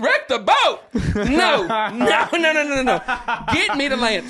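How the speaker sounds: high, loud incredulous outburst; horrified refusal. Retold or imitated. Panicked passenger rejecting his friend's plan to wreck the boat, loud disbelief.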